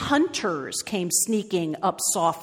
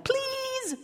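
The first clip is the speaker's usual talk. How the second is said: very high, soft plea; begging; 7 dB quieter than usual. Acted. Bird begs the elephant to sit, high pleading. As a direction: beg in a tiny high squeal. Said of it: "begged the bird".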